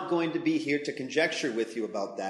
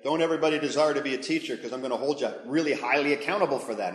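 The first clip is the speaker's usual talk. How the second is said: fast paraphrase; 5 dB louder, faster than usual. Retold or imitated. Paraphrasing James as God.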